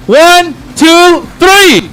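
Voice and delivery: rising countdown to the crowd; calling countdown. Real, not acted. Host counting the crowd in, rising call.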